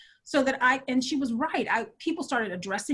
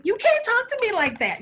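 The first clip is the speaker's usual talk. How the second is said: indignant retort; 11 semitones higher, 5 dB louder than usual. Retold or imitated. Retold defiant retort to advisor.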